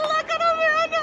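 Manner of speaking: very high-pitched excited squealing; fangirl squeal. Real, not acted. Fan showing off Amanda on camera, squealing. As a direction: squeal in starstruck delight.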